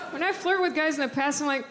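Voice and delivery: usual speaking voice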